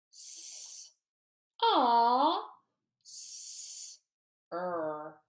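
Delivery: "S, aw, s, er" each sound on its own, slow and separated. Shown as a demonstration. Teacher sounding out saucer for the class, slow and separated. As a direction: tap out "S" "aw" "s" "er" one by one. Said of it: "Say the sounds as I point at them".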